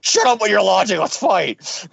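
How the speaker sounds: ordinary talk, nothing marked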